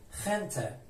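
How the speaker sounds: خنته opening on a throaty kh. Shown as a demonstration. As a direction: say خنته with g pronounced as kh. («خنته» با صدای خ)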